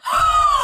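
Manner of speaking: loud, high gasp of shock. Pretend. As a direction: gasp sharply at a twist. Shock at the plot twist.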